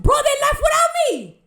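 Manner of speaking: high, very loud cry of disbelief; outraged yell. Real, not acted. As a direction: yell it at the top of your voice. Outrage at being left behind.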